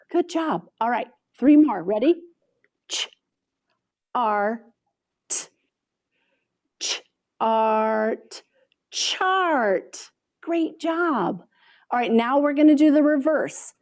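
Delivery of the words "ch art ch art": onset and rime split, a pause between; sounds pulled apart. Shown as a demonstration. Teacher segmenting a word for students, pausing between sounds. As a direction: break it into ch and art for the kids. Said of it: "you're gonna take it apart".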